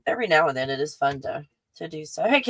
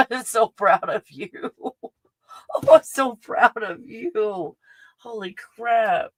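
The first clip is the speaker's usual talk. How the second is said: slow, higher, laughing gush; overjoyed, pitched up and lingering; proud gushing; 4 semitones higher, slower than usual. Real, not acted. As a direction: draw it out with delighted pride. Overjoyed for friend's achievement.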